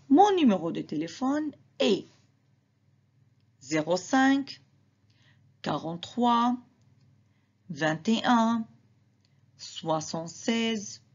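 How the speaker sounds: ordinary delivery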